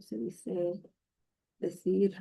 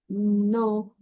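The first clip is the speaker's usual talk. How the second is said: ordinary talk, nothing marked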